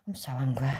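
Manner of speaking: whispered under the breath; a hushed, seething whisper. Real, not acted.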